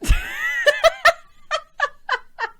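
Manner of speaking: high, loud giggle. Real, not acted. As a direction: giggle at her own joke. Catches herself mid-joke.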